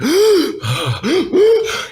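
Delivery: sharp high theatrical gasp; hammed-up shocked gasp. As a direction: gasp in mock alarm. Fake priest spots danger.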